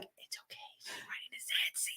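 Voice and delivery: hushed whisper to passers-by. Retold or imitated. To passers-by, a hushed reassuring whisper. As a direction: whisper it so she can't hear. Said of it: "and I was like".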